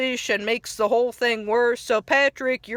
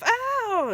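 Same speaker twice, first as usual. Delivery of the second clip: high cartoon yelp; a pained little cry, pitched up; mock yelp of pain; 11 semitones higher than usual. SpongeBob in pain, retold.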